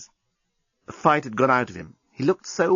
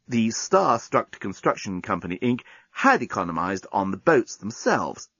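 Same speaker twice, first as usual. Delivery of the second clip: ordinary delivery, nothing marked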